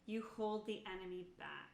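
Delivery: usual speaking voice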